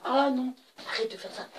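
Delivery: high, loud mock scolding mother; imitating his mother. Kid mocking classmate's mother scolding him, high mock scold. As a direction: play the mother telling him to stop. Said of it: "Je suis sa mère".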